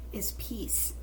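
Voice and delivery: soft breathy whisper. Real, not acted. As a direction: drop to a whisper. Soft reflective aside.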